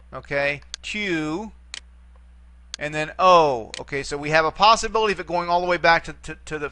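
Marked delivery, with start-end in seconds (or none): 0.8-3.7 "tu" and "o" each sounded separately, with a long pause between; syllable by syllable. Shown as a demonstration. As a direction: count out the syllables "tu", then "o". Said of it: "it too has three syllables".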